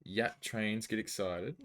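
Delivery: usual speaking voice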